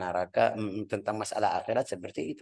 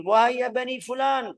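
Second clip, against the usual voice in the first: much higher, louder summons; a ringing call to the clan; 13 semitones higher, 5 dB louder than usual. Retold or imitated. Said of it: "ada panggil". (memanggil keras dengan nada tinggi)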